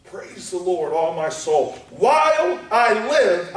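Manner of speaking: usual speaking voice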